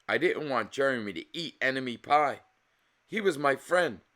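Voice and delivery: plain reading voice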